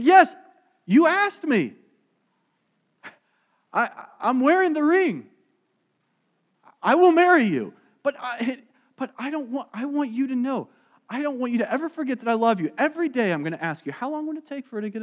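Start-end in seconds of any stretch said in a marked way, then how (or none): 0.0-7.7 loud, high, exasperated yes; frustrated. Retold or imitated. Fiancée fed up with daily proposals, loud and exasperated. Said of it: "get a little frustrated". 8.0-13.9 frustrated. Retold or imitated. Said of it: "get a little frustrated".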